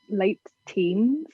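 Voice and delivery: ordinary talk, nothing marked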